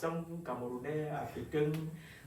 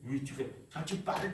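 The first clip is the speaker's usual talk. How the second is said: usual speaking voice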